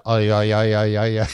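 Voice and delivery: a low, lightly voiced imitation of Chuck's "ay, ay, ay"; ay ay ay sound. Podcaster imitating Chuck's goofy sound, lightly voiced. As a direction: mimic the character's little ay ay ay under his breath. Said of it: "and it makes the sound".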